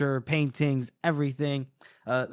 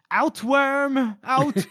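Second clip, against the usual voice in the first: high, raised-voice banishment; scornful dismissal; 11 semitones higher, 4 dB louder, much slower than usual. Acted. Ordering the beaten man out of the house, scornful. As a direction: throw him out with pitch soaring. Retold character throws him out.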